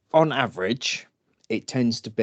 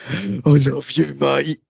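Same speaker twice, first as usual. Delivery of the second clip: put-on loud, slow, high drunken mate; drunk guy; 4 semitones higher, 4 dB louder, slower than usual. Game drunk to his rescuer, slurring fondly. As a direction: slur it like a happy drunk. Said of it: "he would go".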